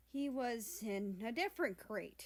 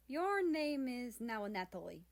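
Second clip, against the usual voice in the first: put-on louder, lightly Russian-accented announcement; 4 semitones higher, 4 dB louder than usual. Russia naming his new cat, light Russian accent. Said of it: "spoke with a heavy Russian accent".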